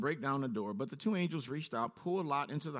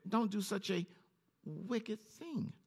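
begging; 5 semitones higher, slower than usual. A narrator's reading. Lot begging the men to spare his guests, mild plea. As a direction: plead mildly, hushing the last words. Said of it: "he begged, Lot begged".

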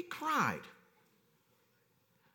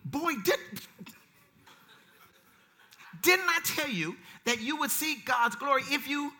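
scolding mother; 6 semitones higher, 4 dB louder, slower than usual. Retold or imitated. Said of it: "I get a picture of my mother".

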